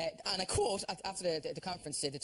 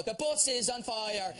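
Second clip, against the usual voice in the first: slow drawn-out warning; much slower than usual. Pretend. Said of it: "in Northern Irish fans".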